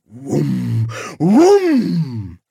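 whump twice, building; imitated booms. A narrator's reading. Sound of the pot firing.

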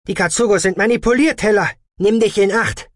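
high, loud, rushed cry; urgent warning. A narrator's reading. Warning Teller that the robots are manipulated, urgent shout. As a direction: shout a fast alarm.

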